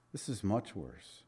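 quiet grave repeat; hushed, grave. Real, not acted. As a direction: let it sink in softly. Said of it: "repeated for emphasis".

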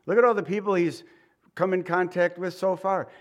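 ordinary delivery

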